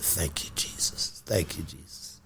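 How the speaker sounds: hushed, breathy thanks to Jesus; quiet mock prayer of relief; relieved praise. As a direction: whisper it like a grateful prayer.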